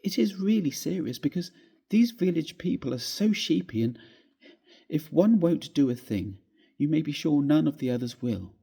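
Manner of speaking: high, fretful wife's voice; upset young wife. Acted. Wife tells her husband the servant is leaving, upset. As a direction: pour out the worry, pitched high and tearful. Wife continues tearful complaint.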